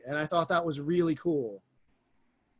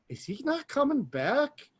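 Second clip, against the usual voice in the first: high, slow mock dismay; mock disbelief; 7 semitones higher, slower than usual. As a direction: ask it in hammed-up disbelief. Retold shock at a character's death.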